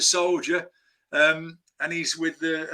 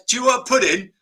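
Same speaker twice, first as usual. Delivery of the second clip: lightly mimicked star, pitched higher; Michael Caine; 5 semitones higher, 4 dB louder than usual. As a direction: imitate a famous London actor offering dessert. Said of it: "do my Michael Caine impression".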